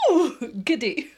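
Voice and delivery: laughing, high and loud; giddy laugh. Retold or imitated. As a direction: giggly burst. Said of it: "pressed record and gone".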